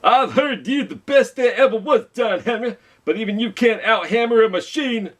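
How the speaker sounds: high, scornful boss voice. Acted. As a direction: look down on John Henry from way up high. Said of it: "The man laughed".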